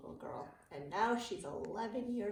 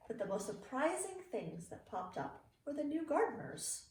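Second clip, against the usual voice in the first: put-on reading to children. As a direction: read it like a bedtime picture book for kids. Reading a picture book aloud.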